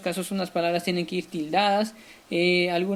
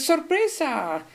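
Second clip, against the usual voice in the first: sorpresa high and drawn out; exclaimed surprise; 7 semitones higher, slower than usual. Shown as a demonstration. Teacher repeating the example, high surprise. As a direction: call out ¡sorpresa! with a lift. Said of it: "Cuando dices".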